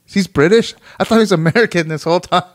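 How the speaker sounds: high, loud disbelief; genuine surprise. Real, not acted. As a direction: blurt it out, astonished at the discovery. Just found out Depp is British.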